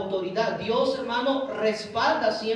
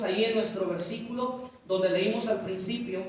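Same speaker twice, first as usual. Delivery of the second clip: ordinary delivery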